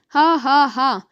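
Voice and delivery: loud, spaced-out "ha ha ha"; acted storybook laugh; mocking laughter. A narrator's reading. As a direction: laugh scornfully, falling in pitch.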